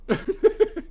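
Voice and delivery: short high chuckle; laughing. Real, not acted. Amused commentator reacting to the Supreme Court case, high chuckle. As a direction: break into a brief real laugh. Amused by his own point.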